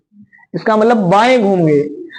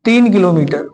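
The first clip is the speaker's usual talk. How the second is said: slow, paused dictation for students to write; feed the class the question phrase by phrase; 3 semitones lower than usual. Shown as a demonstration.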